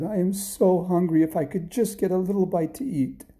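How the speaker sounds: high, small begging voice; pleading hungry boy. Acted. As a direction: beg pitifully for a bite. Hungry Jack pleading with wife.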